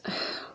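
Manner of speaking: a soft, quiet sigh; sighing before answering Kat. A narrator's reading. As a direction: let out a weary sigh. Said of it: "Lilia sighs".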